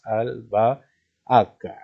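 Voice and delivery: "albahaca" broken slowly into syllables. Shown as a demonstration. Teacher splitting albahaca into syllables for students, slow and separated. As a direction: draw out each syllable of "albahaca". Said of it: "Lo vamos a separar en sílabas".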